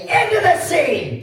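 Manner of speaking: slow, high, loud chant cue; rallying shout. Shown as a demonstration. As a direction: shout it out for the crowd to copy. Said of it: "we're all going to say".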